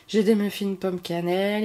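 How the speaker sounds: ordinary delivery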